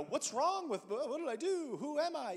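high, flustered mock panic at a crying baby. Stranger holding a crying baby, in high mock panic. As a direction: play the stranger panicking over what he did.

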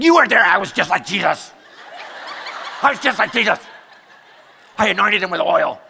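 loud, high, hammed-up self-defence; indignant protest. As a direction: shout your case to your wife in mock outrage. Indignantly defending himself to wife.

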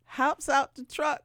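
normal talking voice

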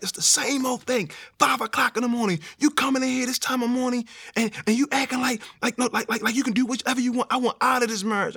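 imitating a scolding wife in a high voice; fed-up wife. Wife confronts husband coming home at five, fed-up high voice. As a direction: pitch it well up and nag in protest.

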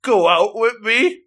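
halting, pitch climbing with each phrase; hesitant love confession. Retold or imitated. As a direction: build up nervously to the big question.

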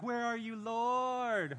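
high, whiny mock prayer; mock desperate plea. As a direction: ham up a desperate cry to God. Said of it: "I'm like".

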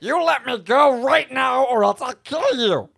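loud, slow threatening ultimatum; loud threat. Acted. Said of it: "I was like".

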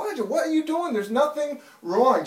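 mock-frustrated scolding of a crying baby. Tired father scolding his son at two in the morning, exasperated. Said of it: "I got to the point so frustrated".

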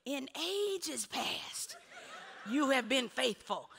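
drawn-out, high imitation of a praying pastor; mock-grand chanted prayer, very slow; pastor praying loud. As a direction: stretch the words like a preacher's rote prayer. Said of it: "when he got loud".

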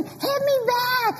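put-on high, slow childish taunt; taunting little sister. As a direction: goad like a feisty kid. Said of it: "And she'd keep hitting".

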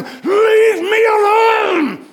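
very high, stretched mock scream; drawn-out shrieked demand; retold yell. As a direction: holler it long, imitating a man defying God. Retells man yelling at God.

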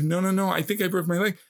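high, pleading protest; urgent insisting. Retold or imitated. Boy insisting to the teacher that his leg broke, urgently. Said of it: "and I was like".

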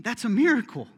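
usual speaking voice

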